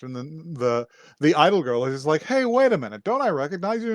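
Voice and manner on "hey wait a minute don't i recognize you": high-pitched acted double take; sudden recognition. Idol girl stops the masked stranger, sudden recognition.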